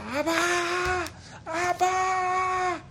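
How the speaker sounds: very high, long-held call for father; child's desperate cry. Retold or imitated. Son torn from his father by Nazis, crying out. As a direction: cry out high, stretching the word. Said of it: "él empezó a gritar".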